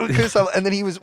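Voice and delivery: usual speaking voice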